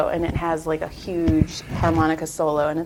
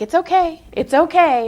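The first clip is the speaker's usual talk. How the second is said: higher, louder mock reassurance; reassuring; 10 semitones higher, 5 dB louder than usual. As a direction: brightly insist you're fine. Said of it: "and I'm like".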